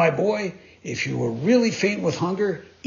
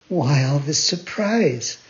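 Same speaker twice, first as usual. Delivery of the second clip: ordinary delivery, nothing marked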